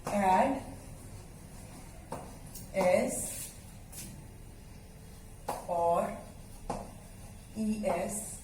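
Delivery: one word at a time, long pauses; spaced-out words, paced to the writing; word-by-word dictation. Real, not acted. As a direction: dictate slowly as you write it out. Dictating while writing out the heading.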